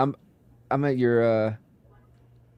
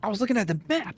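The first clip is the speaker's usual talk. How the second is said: alarmed; 10 semitones higher, faster than usual. Real, not acted. Lost in dark, alarmed.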